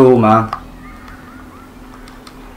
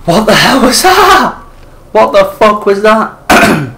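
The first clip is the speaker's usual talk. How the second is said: terrified high-pitched yell; 9 semitones higher, 6 dB louder, slower than usual. Real, not acted. Monster again, high scared cry.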